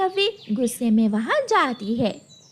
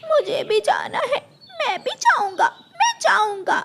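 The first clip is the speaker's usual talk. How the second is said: put-on high pleading little boy; high whiny kid voice; whiny child; 10 semitones higher than usual. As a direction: beg like a small child. Child begs to go too.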